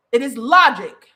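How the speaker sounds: normal talking voice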